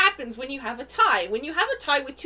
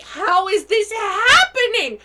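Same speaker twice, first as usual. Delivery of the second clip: disbelief; 7 semitones higher, 8 dB louder, slower than usual. Retold or imitated. Retelling disbelief at own rolls.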